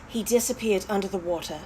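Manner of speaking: ordinary delivery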